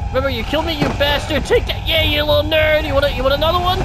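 high, loud gloating shouts; excited taunting. Pretend. Player pelts the villain who killed him, excited taunting shouts.

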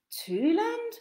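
high, wondering; musing aloud; puzzled thought. Acted. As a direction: puzzle over the strange name. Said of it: "he thought to himself".

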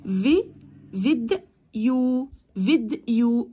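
"فيديو" broken as vid, yo; split syllables. Shown as a demonstration. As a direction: leave a gap inside "فيديو" after vid. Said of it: "تقسموا الكلمة لمقاطع". ("فيديو" متقطعة فيد ثم يو)

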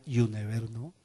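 Spanish speaker putting on a light gringo accent; gringo-style English. Shown as a demonstration. Pastor has the church say it the gringo way. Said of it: "al estilo gringo".

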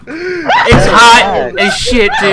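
high, drawn-out protest; exasperated complaint. Real, not acted. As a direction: complain slowly at a high pitch. Loud complaint about the heat.

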